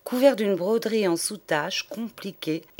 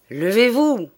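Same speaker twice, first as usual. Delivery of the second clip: stern order; 4 semitones higher, 7 dB louder than usual. Acted. Teacher repeats order.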